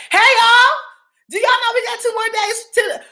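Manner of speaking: high, bright-up call to the chat; excited hey y'all. Real, not acted. Host greeting her live chat, pitched high and excited.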